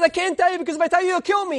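high, rushed acted protest; desperate plea. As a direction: plead fast with a deadly ruler. Teacher pleads for his life.

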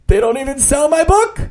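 gasped, high worried question; anxious gasp. Acted. Gasps, fears book unsold.

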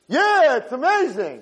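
high, slow, drawn-out mock cheer; feigned enthusiasm. Father humouring his son's second miss, feigned enthusiasm. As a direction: overdo the fake delight. Father fakes praise again.

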